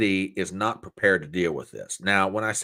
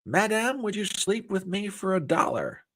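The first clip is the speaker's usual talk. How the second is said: much higher, put-on old-time comic voice; W C Fields voice; 10 semitones higher than usual. Joke man propositioning a woman, in a high put-on comic voice. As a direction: croon it like a vaudeville charmer. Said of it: "W C Fields looked at the same woman and said".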